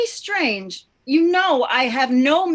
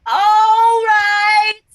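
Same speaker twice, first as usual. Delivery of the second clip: loud raised-voice reply; shouting; 8 semitones higher, 11 dB louder than usual. Acted. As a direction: shout it to be heard. Loud reply to deaf man.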